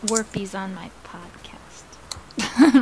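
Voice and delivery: slow soft whisper. Real, not acted. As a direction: whisper it slowly. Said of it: "whisper heard".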